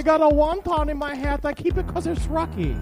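high, hammed-up Chinese accent; mock Chinese accent. Character guarding his lucky wonton, high hammed-up Chinese accent. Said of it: "how much I do Hong Kong".

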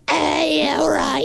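high, silly put-on voice; squeaky comic voice; high goofy voice. Mock voice of an admirer.

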